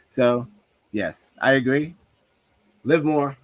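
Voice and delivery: ordinary delivery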